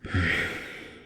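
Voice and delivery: sigh. Acted. Weary pause, going over it again.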